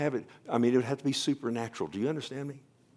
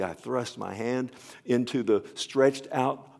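louder and higher, building with wonder; mounting fervour; 5 semitones higher, 7 dB louder than usual. Retold or imitated. Preacher retelling the healing moment, rising loud. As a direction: swell with the rush of warmth. Healing flood, retold climax.

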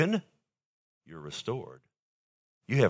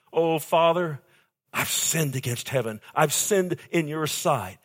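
loud, higher-pitched plea to father; pleading cry; 5 semitones higher, 5 dB louder than usual. Acted. The son crying out to his father, loud plea. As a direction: cry it out, begging forgiveness.

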